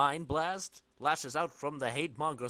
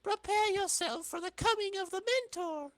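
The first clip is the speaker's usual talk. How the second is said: much higher, solemn herald voice; cult herald. Acted. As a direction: proclaim like a cult announcer.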